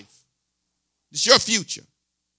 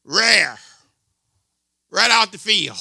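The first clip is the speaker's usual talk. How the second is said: comic steak order; 4 dB louder, slower than usual. Retold or imitated. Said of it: "I wanna say steak".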